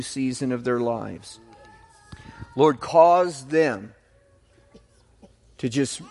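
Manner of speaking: earnest, measured prayer voice. Acted. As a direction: pray over the couple with conviction. Leads a prayer.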